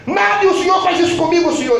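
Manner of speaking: grito agudo de protesto a Deus; clamor alto. Retold or imitated. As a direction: clama a Deus sem entender. (clamor alto a Deus)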